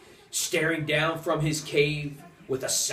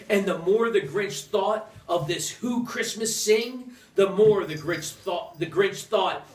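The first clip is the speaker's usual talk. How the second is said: building; 5 semitones higher, 4 dB louder than usual. A narrator's reading. Grinch stewing, building.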